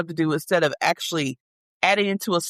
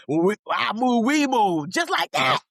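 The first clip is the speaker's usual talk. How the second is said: higher, drill-like parent orders; commanding mom voice; 4 semitones higher than usual. Acted. Mom acting out getting kids moving, commanding and high. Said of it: "put some bass in your voice".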